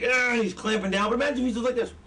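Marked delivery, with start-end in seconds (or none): 0.0-0.3 mock action-hero exertion noise; strained grunt. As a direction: imitate straining to clamp down. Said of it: "And he's like".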